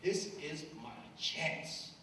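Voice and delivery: slow, voiced and deliberate; slow inner resolve. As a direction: say it slowly, steeling yourself. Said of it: "And I'm like".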